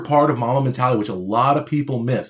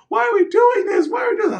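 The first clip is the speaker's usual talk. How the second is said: put-on crying child's wail. Boy crying to his dad at dawn practice, high tearful shout. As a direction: wail it like a crying eight-year-old who is fed up. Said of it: "I'm crying".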